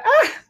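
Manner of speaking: sudden high burst of laughter; surprised laugh. Real, not acted. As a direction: let a laugh pop out. Laughs at her own slip. (risa repentina)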